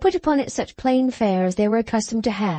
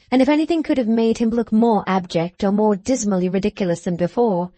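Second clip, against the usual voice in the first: usual speaking voice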